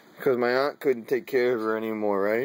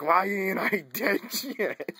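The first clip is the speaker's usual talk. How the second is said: higher mock complaint broken by laughter; laughing quote; 5 semitones higher than usual. Imitating grandma in the hospice, laughing. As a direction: crack up while imitating the complaint.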